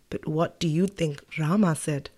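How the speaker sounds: ordinary delivery, nothing marked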